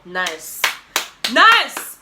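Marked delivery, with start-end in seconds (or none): 1.3-2.0 loud, high burst of approval; excited praise. Retold or imitated. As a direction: exclaim it, far bigger and higher. Repeats praise as an exclamation.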